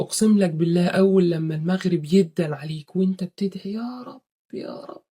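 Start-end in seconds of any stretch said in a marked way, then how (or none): 3.7-5.0 choked, fading pleading cry; رجاء باكي متقطع; tearful plea. Shown as a demonstration. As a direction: beg with tears at sunset prayer. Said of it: "أول لما أنت تبكي".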